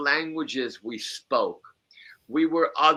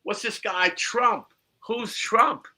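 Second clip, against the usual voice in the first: high, baffled voice of the man asking; mock bewildered asker, high; puzzled man; 10 semitones higher, slower than usual. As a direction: play the confused guy, pitched well up. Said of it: "he looks at me and goes".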